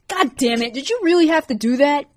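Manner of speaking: indignant yelp at being dumped on; annoyed outburst. A narrator's reading. Sam annoyed at Leah dumping her lunch on him.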